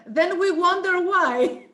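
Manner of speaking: slow, high, drawn-out wonder; mock wondering. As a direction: play up the disbelief. Joking about singers' bad habits.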